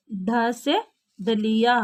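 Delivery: ढ से डलिया drawn out slowly, each syllable clear; slow letter demo. Shown as a demonstration. Teacher to children: slowly sounds out the letter word. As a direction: spell it out slowly for the class. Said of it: "देखो ऐसा लिखना है।".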